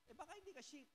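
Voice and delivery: ordinary delivery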